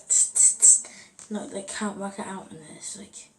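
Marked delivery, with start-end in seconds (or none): 0.1-0.8 sharp repeated "tss" noises; imitated lighter clicks. Friend imitates nan trying the lighter, sharp hissed clicks. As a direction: mimic a lighter failing to catch. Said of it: "she's like".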